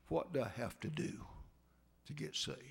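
whispered, dying man's question; whispered plea. Retold or imitated. Dying master whispering to Mose how to be saved. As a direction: breathe it out like a dying man. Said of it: "dying master asks".

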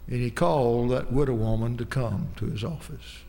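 ordinary talk

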